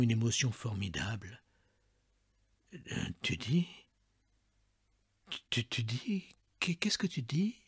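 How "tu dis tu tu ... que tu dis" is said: softer, stammering, fading to a whisper; barely able to get the words out; stunned father asking in a faint voice; faint trembling voice. A narrator's reading. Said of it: "d'une voix éteinte, tremblante".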